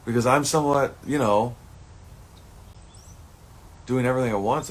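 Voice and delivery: venting hard at his parents and entourage; real upset. Real, not acted. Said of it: "I'm, I'm, I'm emotional".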